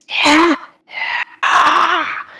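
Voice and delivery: high, eerie angry vocal noise; angry whispering sound. Acted. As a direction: imitate an angry spirit sweeping past. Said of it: "It sounds angry, this whisper that keeps coming through".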